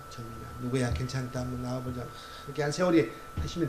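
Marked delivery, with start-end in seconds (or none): 0.1-2.0 gentle, quiet coaxing; soft coaxing. Retold or imitated. Preacher's wife coaxing a runaway boy back to church, quiet. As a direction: draw the boy back softly.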